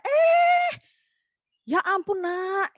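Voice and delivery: put-on exasperated parent jolted by the child; startled exclamation. (seruan kaget orang tua, jauh lebih tinggi dan keras)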